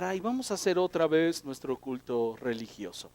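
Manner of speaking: a heavy, joyless groan of obligation, sinking away; widerwillig klagend. Acted. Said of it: "una actitud de pesar".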